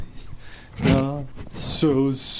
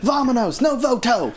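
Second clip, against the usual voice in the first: hammed-up southern accent, higher; 5 semitones higher than usual. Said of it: "you always give them a southern accent".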